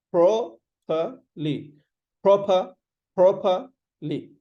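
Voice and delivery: properly in slow parts, PRO, puh, lee; "properly" built up piece by piece; syllable-by-syllable properly. Shown as a demonstration. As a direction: say properly broken up with pauses. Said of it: "this is how you will say".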